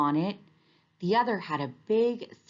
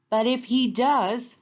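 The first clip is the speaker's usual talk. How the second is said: frowning; 7 semitones higher, 5 dB louder than usual. A narrator's reading. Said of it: "frowned Alligator".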